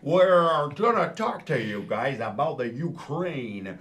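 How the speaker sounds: loud, put-on American accent. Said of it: "do my American accent now".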